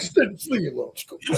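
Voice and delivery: soft, sulky brush-off; mock pouting. Humbled athlete sulking to his girlfriend, mock pouting. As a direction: pout like you want to be left alone. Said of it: "like, pouting".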